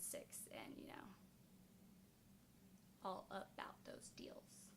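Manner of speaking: soft whispered aside; whispering. Real, not acted. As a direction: whisper it like a secret. Conspiratorial aside about cheap price.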